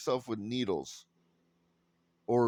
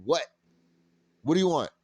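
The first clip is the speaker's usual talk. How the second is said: louder, challenging bark; louder standoff challenge from the teller; confrontational; 3 semitones higher, 4 dB louder, much faster than usual. Retold or imitated. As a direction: square up to them. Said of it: "and you go".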